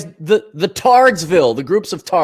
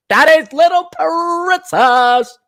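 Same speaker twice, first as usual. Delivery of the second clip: high, slow, sneering; lightly voiced spoiled daughter; mock princess; 11 semitones higher, 4 dB louder, slower than usual. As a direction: drag it out like a pampered princess. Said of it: "mocking daughter".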